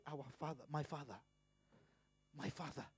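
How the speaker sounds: softly praying "my Father"; quiet prayer. Pretend. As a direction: murmur it like calling to a father in prayer. Voicing a humble prayer.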